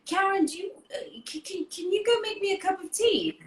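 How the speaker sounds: high, stammering embarrassed father; awkward dad. Retold or imitated. Dad dodging a love scene by asking for tea, awkward stammer. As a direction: hem and haw to escape the scene. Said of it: "he'd be like".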